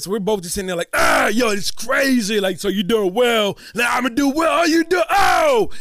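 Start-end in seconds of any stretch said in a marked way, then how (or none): 0.9-2.4 loud, raised, excited impression; Will Smith impression. Two impressionists trading the same star live, loud excited impression. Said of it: "Will Smith talking to Will Smith". 2.4-5.7 high, loud, stretched-out shouting; Will Smith impression. Two impressionists going back and forth, building excited shout. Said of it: "Will Smith talking to Will Smith".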